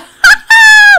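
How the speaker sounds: very loud, high squeal of laughter; shrieking laugh. Real, not acted. Streamer celebrating the fixed lag, shrieking laugh. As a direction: burst into an elated shriek-laugh.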